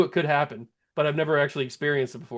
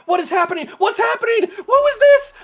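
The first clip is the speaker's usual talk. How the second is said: much higher, much louder disbelieving yells; shocked shouting; 10 dB louder than usual. Real, not acted. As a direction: shout in pure disbelief at the glitch. Ball floating, game glitch.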